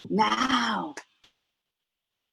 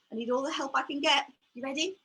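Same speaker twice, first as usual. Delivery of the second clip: normal talking voice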